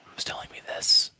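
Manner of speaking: whispered side conversation. Real, not acted. Game master answering a player's private question, whispered.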